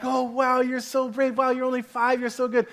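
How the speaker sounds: high-pitched imitation of admiring strangers; cheering her on. People in line to the five-year-old, retold cheering. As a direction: gush encouragement at a small child. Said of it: "they're cheering her on".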